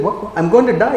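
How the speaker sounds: high, acted disbelief; incredulous protest. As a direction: protest as someone told nonsense while dying. Acts out a dying person's protest.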